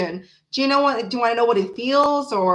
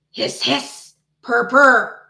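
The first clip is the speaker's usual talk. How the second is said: playful cat hiss; 5 dB louder, much slower than usual. Pretend. Reader doing her chit chat with kitty cat bit, playful hissing. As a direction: pretend hissing, then a louder purr. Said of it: "do my little hiss, hiss".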